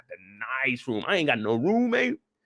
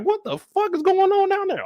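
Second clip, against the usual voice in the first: high alarmed call; 4 dB louder than usual. Retold or imitated. Neighbor calling down hallway.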